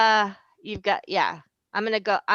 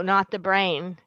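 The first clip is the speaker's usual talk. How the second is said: ordinary talk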